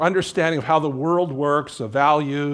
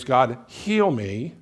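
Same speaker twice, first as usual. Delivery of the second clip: prayer; slower than usual. Shown as a demonstration. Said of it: "One of the prayers you can pray is".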